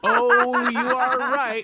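loud, high burst of laughter through the words; laughing. Real, not acted. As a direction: crack up while agreeing. Host cracks up at bad accent.

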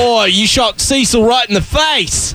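hammed-up Australian outrage; Australian accent. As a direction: protest in broad Aussie. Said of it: "I'm doing an Australian accent".

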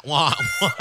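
wah-wah like a sad trombone; mock a losing horn; sad trombone noise. Mocking loser with sad trombone.

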